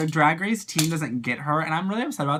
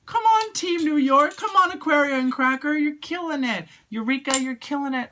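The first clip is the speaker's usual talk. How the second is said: high, urgent cheer; root for your team at a high pitch; excited cheering; 12 semitones higher than usual. Real, not acted. Fan cheering on Team New York, high and excited.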